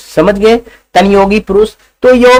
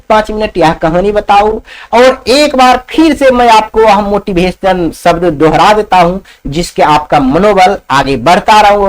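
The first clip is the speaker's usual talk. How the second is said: plain reading voice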